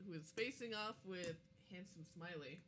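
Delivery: ordinary talk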